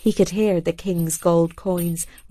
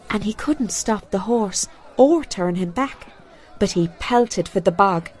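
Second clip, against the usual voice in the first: plain reading voice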